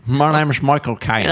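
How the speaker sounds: lightly voiced slow Michael Caine drawl; a halting try at his slow English drawl; Michael Caine impression. Asked by the host to try it, a light slow drawl. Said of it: "Prøv det inn".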